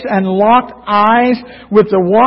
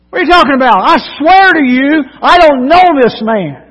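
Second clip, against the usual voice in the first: raised, higher voice, swearing he never knew him; swearing oath; 7 semitones higher, 6 dB louder, faster than usual. Retold or imitated. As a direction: swear it hotly, much louder and higher. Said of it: "He denied it again with an oath".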